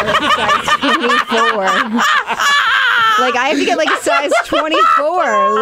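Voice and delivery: high, laughing through the words; real laughter, pitch jumping up; laughing squeal. Real, not acted. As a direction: crack up at the size. Laughing at own tiny size.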